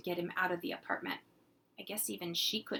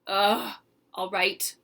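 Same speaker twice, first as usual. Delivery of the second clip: exasperated; 8 dB louder, slower than usual. Acted. Annoyed big brother gives in.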